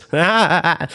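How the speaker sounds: high-pitched put-on mad cackle; mad laugh. Host jokes about being called mad, mock crazed laugh. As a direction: fake a madman's laugh. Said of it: "Would a mad person laugh like this?".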